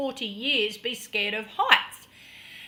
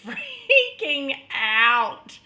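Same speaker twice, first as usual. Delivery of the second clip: "freaking" stretched slow and loud; emphatic FREAKING; 5 dB louder, much slower than usual. Real, not acted. Stresses how panicked she was.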